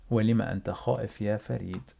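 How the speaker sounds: rattled off at full speed in one breath; fast natural speed. Shown as a demonstration. Teacher to learners, fast natural-speed reading. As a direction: run the whole question together quickly. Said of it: "let's read together".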